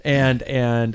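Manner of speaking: normal talking voice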